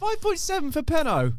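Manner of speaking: high, stunned disbelief; outraged disbelief. Real, not acted. Outraged at a rating.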